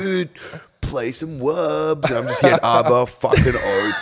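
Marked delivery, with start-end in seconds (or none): 0.0-2.1 high, slow, slurred mock punter voice; drunk punter. A drunk clubgoer begs the DJ for a song, slurred and slow. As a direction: ham up a wasted clubgoer begging for a track.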